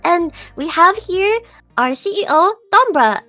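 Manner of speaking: normal talking voice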